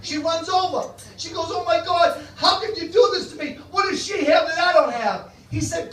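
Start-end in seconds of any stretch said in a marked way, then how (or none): 1.5-5.3 louder, higher, rising alarm as she confronts him; hammed-up jealous outburst; upset old woman. Old woman confronting her cheating partner, upset and loud.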